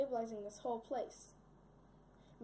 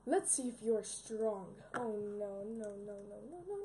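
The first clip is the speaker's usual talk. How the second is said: usual speaking voice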